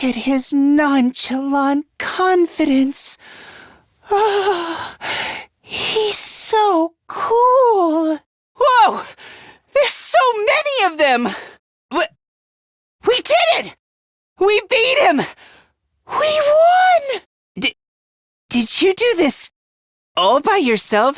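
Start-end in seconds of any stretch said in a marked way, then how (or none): 4.1-8.2 high, drawn-out dreamy sigh; swooning admiration. Acted. Admiring his nonchalant confidence, high slow swoon. 8.6-11.3 high-pitched astonished cry; startled amazement. Acted. Startled by so many of them, high amazed cry. 13.1-17.2 high, jubilant victory shout; triumphant cheer. Acted. Celebrating that they beat him, high jubilant shout.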